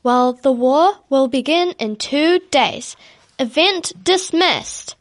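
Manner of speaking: king proclaiming the war to the crowd; royal proclamation. A narrator's reading. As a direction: announce it to the whole crowd.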